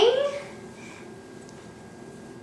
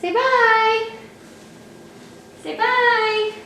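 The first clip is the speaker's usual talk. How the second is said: high-pitched parent prompting a goodbye; 11 semitones higher, 3 dB louder than usual. Real, not acted. Coaxing child to say bye.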